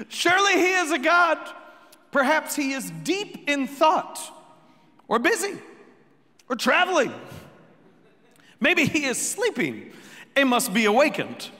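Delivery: high-pitched mocking suggestions; taunting. A narrator's reading. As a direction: needle them with mock helpfulness. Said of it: "Elijah began to taunt them".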